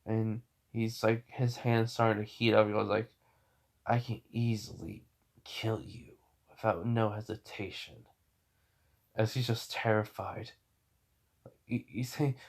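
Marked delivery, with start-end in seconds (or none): 3.8-8.1 near-whispered, menacing warning; quiet threat. Acted. Tanjiro with hand round guy's throat, whispered threat. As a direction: drop to a soft, threatening half-whisper.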